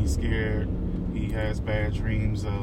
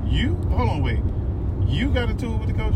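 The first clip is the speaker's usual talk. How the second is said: much higher, incredulous double take; retold disbelief; 12 semitones higher than usual. The league man hearing it was Dre, high-pitched disbelief. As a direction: squeak up in disbelief at the news. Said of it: "He was like".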